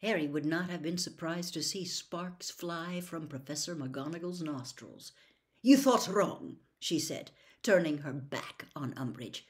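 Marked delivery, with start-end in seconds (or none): 5.6-6.6 higher, louder, firm dismissal; cross, emphatic put-down; angry rebuff. A narrator's reading. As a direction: shut the intruder down. Teacher curtly rebuffs intruder.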